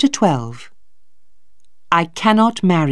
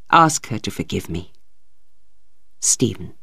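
put-on lower, quiet, remorseful young man; Stephen's letter; 4 semitones lower, 4 dB quieter than usual. Stephen confessing to his father in a letter, low and sorry. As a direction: read it low and contrite.

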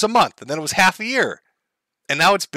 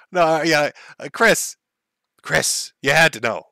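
normal talking voice

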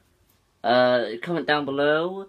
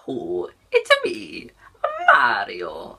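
Mario; slower than usual. Acted. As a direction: leap way up, then drop on the name. Does a Mario impression.

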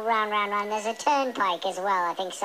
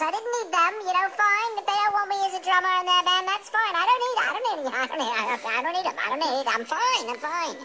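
defensive; 6 semitones higher than usual. Acted. Defensive rant about band rejection.

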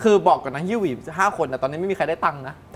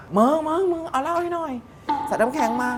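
put-on high, slurred drunk call; 10 semitones higher than usual. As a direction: call out like a tipsy clubber. Drunk calling for a drink.

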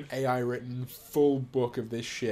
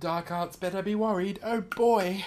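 pitched up, lightly hammed British accent; 6 semitones higher than usual. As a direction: redo the line in a mock British accent, a touch higher. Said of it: "Ah, yes, the accent's important".

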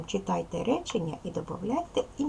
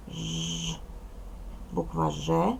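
ordinary talk

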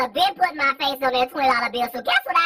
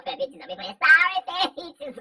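ordinary talk, nothing marked

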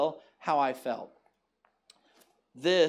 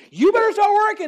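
high, loud yelled command; retold yell at a computer that won't work; angry shout at the computer; 13 semitones higher, 11 dB louder than usual. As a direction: bark it at the broken computer. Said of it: "I got angry at the computer and said".

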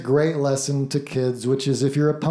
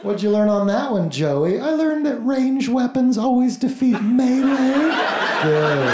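put-on teacher and kid; 8 semitones higher than usual. Acts teacher and kid Joey.

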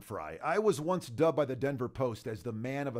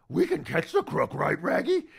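higher, louder cartoon dog voice; Scooby-Doo voice; 3 semitones higher, 5 dB louder than usual. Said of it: "right, Raggy".

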